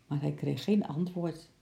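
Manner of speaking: usual speaking voice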